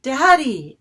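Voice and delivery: loud frightened call for daddy; child calling out in the dark; loud high cry of "Daddy!". Acted. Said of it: "Anna cried".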